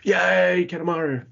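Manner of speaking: happy little whoop; excited cheer. Retold or imitated. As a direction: cheer the name like a kid. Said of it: "I messaged you straight away going like".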